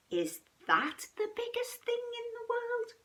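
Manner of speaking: small cub voice, whispered; high whispered question. A narrator's reading. Cub asking about the iceberg, high whisper. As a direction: ask it up high and hushed.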